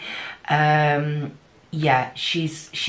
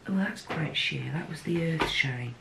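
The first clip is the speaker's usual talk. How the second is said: ordinary talk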